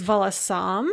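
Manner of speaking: волосам stressed on the final syllable, vo-lo-SAM; two light syllables, then SAM in волосам; stress on third syllable. Shown as a demonstration. Professor showing students the dative plural, волосам stressed on third syllable. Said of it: "with the stress on the third syllable".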